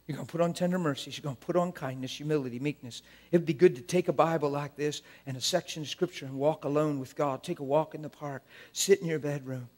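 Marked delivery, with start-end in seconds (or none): none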